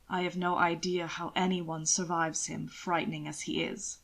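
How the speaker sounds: a sardonic gentleman savouring his jab; low sly nobleman; suave lord, dry. Acted. Hannibal's dry aside about Will.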